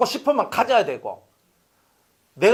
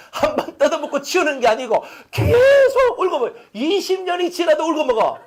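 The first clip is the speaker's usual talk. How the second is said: laughing exclamation; 9 semitones higher than usual. Real, not acted. Preacher mocking with laugh.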